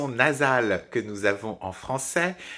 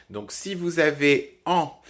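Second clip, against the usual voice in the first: ordinary delivery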